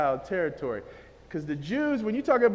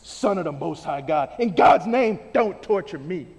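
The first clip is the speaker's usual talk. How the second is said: low, slightly strained pleading demon, understated; a hint of a cornered demon begging; demon crying out; 6 semitones lower than usual. Preacher reading the demon's plea to Jesus, lightly voiced low demon. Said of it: "the demon shouted at the top of his voice".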